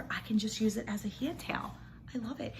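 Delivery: hushed whisper of delight. Real, not acted. Showing viewers the soft towel, whispering lovingly. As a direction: breathe it softly, treasuring it.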